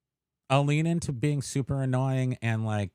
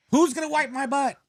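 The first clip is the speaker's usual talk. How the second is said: mock alarm; 14 semitones higher, 4 dB louder than usual. Mock worry about aging town.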